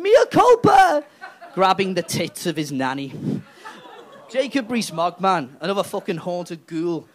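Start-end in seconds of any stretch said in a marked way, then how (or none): 0.0-1.0 much higher, loud, slow toff exclamation; a strangled upper-class yelp; mock posh cry. Rees-Mogg crying out in Latin, very high and loud. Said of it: "Jacob Rees-Mogg will be there going".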